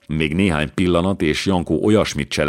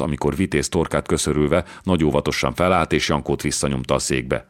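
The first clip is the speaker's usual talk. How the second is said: plain reading voice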